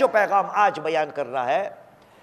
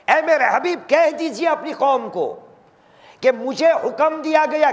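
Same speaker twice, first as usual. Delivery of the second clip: raised, earnest preaching voice; 5 semitones higher than usual. Acted. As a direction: proclaim the verse to the crowd. Sermon preaching the verse. (ऊँची आवाज़ में जोशीला was)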